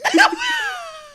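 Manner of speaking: high shrieking laughter. Real, not acted. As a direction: burst into squealing laughter. Laughing at own voice.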